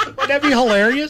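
high, laughing through the words. Real, not acted. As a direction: laugh while asking. Host cracks up at his own joke.

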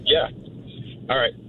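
ordinary talk

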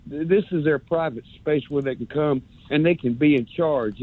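ordinary delivery

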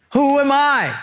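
high-pitched incredulous protest; Moses. Acted. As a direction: play a reluctant prophet, voice jumping up in disbelief. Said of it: "Moses' answer".

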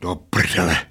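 understated curse, kept low-key; cursing barman. A narrator's reading. Barman cursing at the slot machine, low-key. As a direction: swear to yourself, deadened. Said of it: "ozvalo se od automatu".

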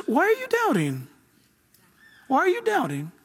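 high, drawn question falling away at the end; questioning Jesus. Acted. Preacher voices Jesus questioning the doubters, high and falling. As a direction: start high on the question, then let it drop.

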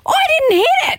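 retelling an accusation, outraged and high; indignant denial. Retold or imitated. Retold denial to accusing lady.

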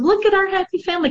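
high, quick, sing-song fake cheer; sarcastic bright chirp; mock cheerful caption. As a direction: sell the perfect family with sarcasm. Mocking fake Facebook captions.

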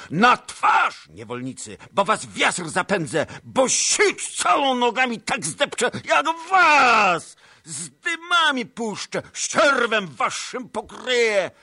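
very high, loud raging yell; furious roar. A narrator's reading. Tuchaj Bej driving off the Cossacks, furious roar. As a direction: tear into them like a tiger.

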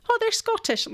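lightly voiced mum, high and breezy. Host voices her mum's confident mistake, lightly mimicked. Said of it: "And she's like".

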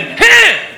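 high clipped fighting yelp; movie-style karate shout; sharp punch grunt. Retold or imitated. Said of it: "like just a well-calculated".

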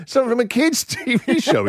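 high-pitched, helpless giggle through words; laughing. Real, not acted. Host cracking up at a listener's name, laughing.

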